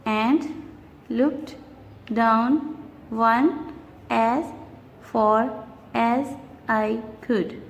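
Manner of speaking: slow, words separated by pauses; syllable by syllable. Shown as a demonstration. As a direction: lay out every syllable slowly. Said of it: "Let's see the fourth one".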